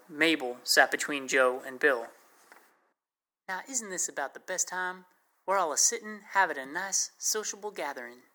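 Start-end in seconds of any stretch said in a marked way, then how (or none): 3.5-8.2 put-on soft, higher Texas accent, lightly done; a hushed, higher Texas girl's accent. A narrator's reading. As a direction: coo it softly in a Texas accent. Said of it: "She had a thick Texas accent".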